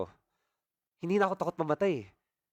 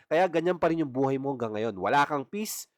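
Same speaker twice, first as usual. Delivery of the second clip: usual speaking voice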